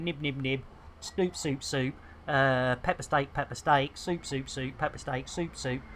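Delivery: low, soft mumble between bites; Zorba munching away. Retold or imitated. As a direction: mutter food words as if busily eating. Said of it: "that's exactly what Zorba's doing".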